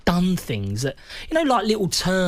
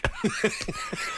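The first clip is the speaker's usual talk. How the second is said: high squealing laugh; 3 dB quieter than usual. Real, not acted. Laughing at the memory.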